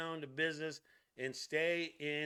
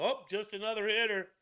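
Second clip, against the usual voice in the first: higher, louder mock nonchalance; mock dismissive; 6 semitones higher, 5 dB louder than usual. Host imitating a pitcher sizing up Shohei, mock dismissive. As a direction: act out a breezy brush-off in a raised voice.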